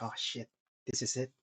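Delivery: quiet, low inner voice; softly, as if thinking to yourself; savoring it under the breath; hushed anticipation. Retold or imitated. Inner thoughts before turning round.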